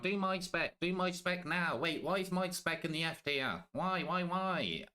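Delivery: high whiny chatter voice; whiny viewer. Retold or imitated. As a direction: whine like an impatient viewer. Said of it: "and they'll be like".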